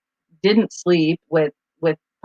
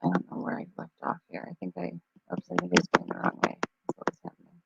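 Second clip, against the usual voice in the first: muttered to self; 13 dB quieter, much faster than usual. Real, not acted.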